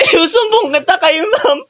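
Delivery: high crying voice; mock crying tattle. Hurt student threatens to tell the teacher, mock crying. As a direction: whine through fake tears.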